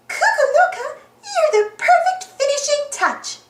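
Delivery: high cartoon mouse praise; high-pitched, thrilled mouse voice; delighted Minnie. A narrator's reading. Minnie praises Cuckoo Loca.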